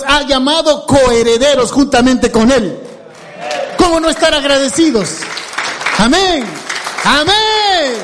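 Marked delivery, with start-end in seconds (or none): 6.0-7.9 exclaimed amen. Real, not acted. Preacher exclaims amen emphatically.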